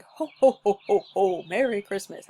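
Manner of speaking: big booming belly laugh; Santa Claus. Shown as a demonstration. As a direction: push out loud ho-ho-hos from the diaphragm. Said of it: "laughing like Santa Claus".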